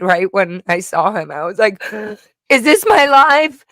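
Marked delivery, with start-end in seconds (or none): 1.9-2.5 gasp. Retold or imitated. Retelling seeing dad. 2.5-3.6 high, loud slow disbelief; high loud stunned question. Retold or imitated. Retold disbelief.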